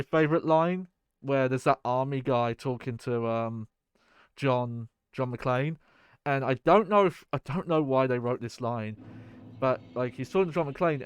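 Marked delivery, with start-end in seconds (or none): none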